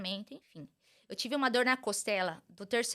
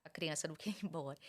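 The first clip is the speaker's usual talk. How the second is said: normal talking voice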